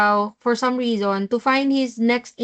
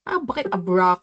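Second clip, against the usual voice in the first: normal talking voice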